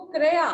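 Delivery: क्रिया as "kray-yaa", loud; wrong pronunciation. Shown as a demonstration. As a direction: say क्रिया with an "ay" vowel, "KRE-yaa". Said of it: "अशुद्ध है".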